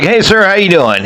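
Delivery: high, showy welcome to a customer; flamboyant greeting. Retold or imitated. Clerk greeting an old customer at the counter, flamboyant and high. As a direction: roll out the red carpet, way up high. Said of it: "kinda flamboyant with my introduction".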